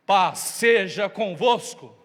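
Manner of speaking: slow, loud, weighty greeting; solemn blessing. Acted. Jesus appearing to the frightened disciples, solemn slow. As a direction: lay the words down slowly like a blessing.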